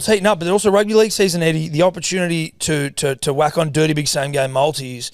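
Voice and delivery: loud, high sponsor read; punchy betting ad voice. Acted. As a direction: sell it like a radio ad.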